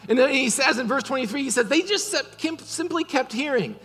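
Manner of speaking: loud, driving preacher voice building to a peak; full-force preaching. Acted. Pastor preaching grace to the congregation, loud and driving. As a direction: preach it hard to the whole room.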